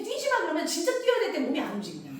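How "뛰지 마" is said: high sharp scold; a parent telling a child off. Retold or imitated. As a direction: snap at a child to stop running. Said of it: "왜냐면".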